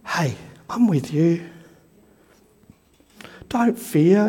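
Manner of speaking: soft, low, tender reassurance; gentle whisper. Pretend. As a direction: whisper it like comforting a child.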